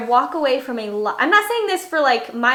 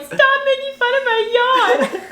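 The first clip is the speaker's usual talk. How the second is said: mock upset whine; 13 semitones higher, 4 dB louder than usual. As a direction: whine in exaggerated protest, laughter creeping in. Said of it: "she got mad at me".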